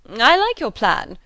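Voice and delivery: loud, high, drawn-out approval; cried out. A narrator's reading. Mister Weston welcoming the plan, hearty cry. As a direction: call it out heartily. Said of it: "cried Mister Weston".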